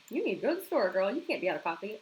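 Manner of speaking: normal talking voice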